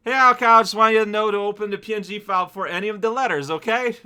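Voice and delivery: high, loud, quick note read; reading note aloud. Acted. Streamer reads viewer's note to chat, high and quick read-aloud.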